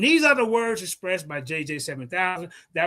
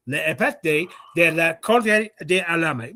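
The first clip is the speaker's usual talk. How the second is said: ordinary delivery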